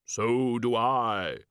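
very slow, drawn-out reply; ponderous unearthly voice; ominous stranger. A narrator's reading. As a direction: stretch each word out gravely. Mysterious stranger answers the demon.

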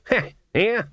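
high, derisive "heh"; scornful snort. A narrator's reading. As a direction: snort at a silly suggestion. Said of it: "Granny Weatherwax snorted".

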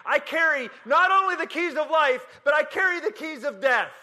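high-pitched, driving revival sermon cadence; impassioned preaching. Real, not acted. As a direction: build it up and proclaim it to the crowd. Preaches at rising intensity.

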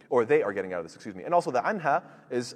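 ordinary talk

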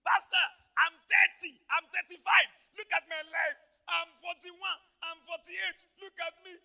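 normal talking voice

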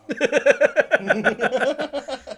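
high burst of laughter; a high, bright giggle. Real, not acted. Laughs after being teased.

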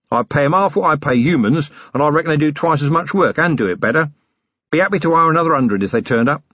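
a blustering self-made man playing host; cheeky boss offering coffee, tea, something stronger; Harry King rough boss. Harry talks about goblin workers.

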